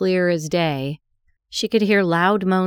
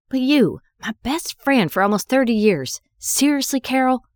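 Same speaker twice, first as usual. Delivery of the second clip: hurt and angry; 7 semitones higher than usual. Acted. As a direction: let the betrayal crack through. Confronting betraying best friend.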